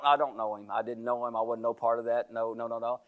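low, soft, trailing denials; acted, hushed backing away; muttered denial. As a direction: disown it under your breath. Peter hurriedly denies knowing him.

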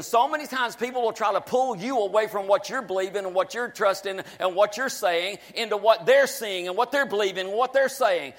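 ordinary talk, nothing marked

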